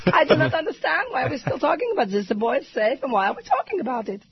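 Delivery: put-on lightly done Greek-accented Ariana, indignant; a hint of Ariana's accent, baffled and firm; Ariana Huffington impression. Host repeating Ariana's on-air complaint, a light hint of her accent. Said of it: "my Ariana impression".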